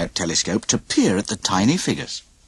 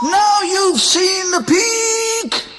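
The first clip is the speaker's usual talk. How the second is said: very high, very slow furious shout; raging shout; much slower than usual. A narrator's reading. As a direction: scream it as you shove him. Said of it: "shouted Donald".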